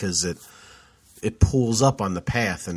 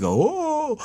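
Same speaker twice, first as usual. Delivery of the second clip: very high startled "Oh!"; falling yelp. Retold or imitated. Slipping on the icy trail, high startled yelp. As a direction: gasp it out mid-fall. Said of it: "So it's more like".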